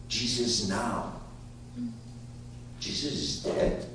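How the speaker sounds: ordinary delivery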